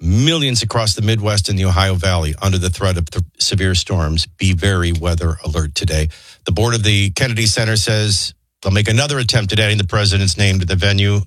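low-pitched radio newsreader; news read. Acted. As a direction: deliver the headlines in a low, measured register. Reads the news headlines.

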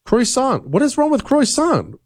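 high-pitched, baffled indignation; high, defensive squawk; indignant protest. Real, not acted. Protests correction of his pronunciation.

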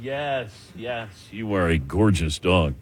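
quiet, slow sweet talk to a good dog; soft dog praise. Real, not acted. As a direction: coo gently at the dog.

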